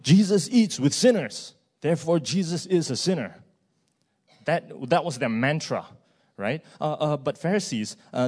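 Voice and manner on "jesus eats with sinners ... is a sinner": higher-pitched mock chant of accusers; accusing slogan. Preacher voices the Pharisees' slogan against Jesus, accusing chant. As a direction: hammer it out like a slogan, building on the repeat. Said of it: "That is their slogan".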